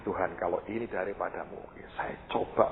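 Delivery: low, soft, tentative prayer; inward prayer. Retold or imitated. Preacher praying before trying the shoes, inward prayer. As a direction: murmur it to God under your breath. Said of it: "Ngomong di hati lah".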